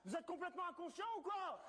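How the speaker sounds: high, scandalized scolding; mock outrage. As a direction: scold him like a fussy cop. Cop scolding suspect.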